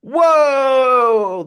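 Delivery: loud, high excited whoa; excited shout. Real, not acted. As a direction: cheer it out big. Reacting to winner announcement.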